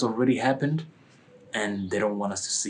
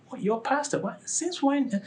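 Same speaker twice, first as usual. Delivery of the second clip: high, disbelieving questions; incredulous; 3 dB quieter than usual. Retold or imitated. Inviter reacting to pastor excuse, incredulous. His incredulous reply, retold.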